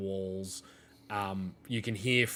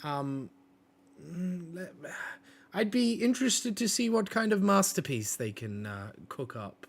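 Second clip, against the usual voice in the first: higher, airy artist voice; artsy Mila; 7 semitones higher than usual. Acted. Mila praises the newcomers' bold mess, higher and airy. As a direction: admire the mess like a painting. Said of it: "Mila then speaks up".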